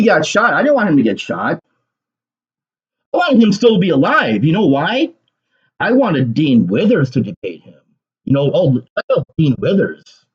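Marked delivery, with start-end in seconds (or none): none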